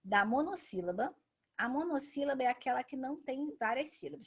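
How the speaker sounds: usual speaking voice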